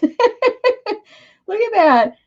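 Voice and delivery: slow, high laughing aside; amused laugh. Real, not acted. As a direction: laugh at the joke on you. Laughing at "pie enthusiast" hashtag.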